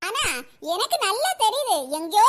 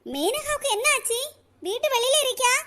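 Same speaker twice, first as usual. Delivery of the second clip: ordinary delivery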